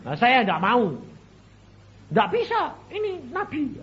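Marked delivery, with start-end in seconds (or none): 2.1-3.8 high-pitched retold protest; imitating an awed believer, pitched high; acted insistence. Acts out insisting worshipper.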